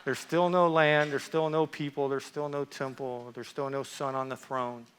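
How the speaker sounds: low, sinking list of losses; deflated. Acted. Preacher listing what Israel still lacks, deflated. Deflated after the excitement fades.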